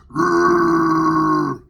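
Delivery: long, very loud raging growl; Hulk growl. Retold or imitated. Man showing his noise as Hulk in the dream, growling. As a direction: let out a huge rolling monster roar. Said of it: "I was doing that in the dream".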